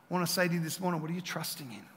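ordinary talk

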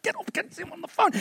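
high, strained mock protest; harried dad. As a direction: act out shooing a child mid-call. Retells shooing child during phone call.

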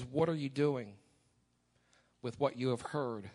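ordinary delivery

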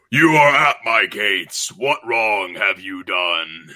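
slow, drawn-out demonic pronouncement; orc Lucifer. Acted. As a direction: intone it like hell's gatekeeper passing judgement. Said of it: "orc Lucifer's like".

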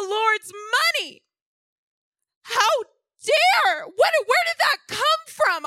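how dare he, building outrage; indignant rant. Retold or imitated. Retelling her outburst in the car, indignant and rising. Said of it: "I'm like".

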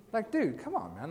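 "dude" pitched well up, exasperated. Pretend. Preacher scolding Jonah, exasperated. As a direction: throw up your hands at Jonah. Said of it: "exasperated aside to Jonah".

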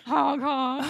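loud, high imitated air horn honks; honking horn. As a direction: blast it out like a silly air horn. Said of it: "climbers took air horns".

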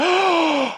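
sharp high gasp of delight; walking in, stunned by the condo; awed gasp. Retold or imitated. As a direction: gasp like you just fell in love. Walks into dream condo.

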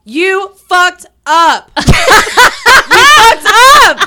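loud, high, slow laughing shout; laughing yell. Real, not acted. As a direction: yell it through laughter, stretched out. Laughing at friend on voicemail.

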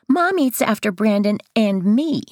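put-on high little boy voice; lightly voiced young boy; child voice. A narrator's reading.

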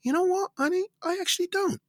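high, light, serene voice; calm reply to wife. Retold or imitated. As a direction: answer the wife with mild surprise. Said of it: "And I'm like".

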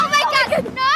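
loud startled oh my god; shocked gasp. Acted. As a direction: react like you just saw something awful. Shocked at huge pimple.

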